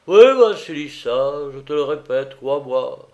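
boozy, pleading declaration; drunken master, earnest. A narrator's reading. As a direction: swear sincerity through the drink.

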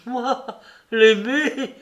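hammed-up indignant denial; indignant cry. A narrator's reading. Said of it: "l'œil brillant d'indignation".

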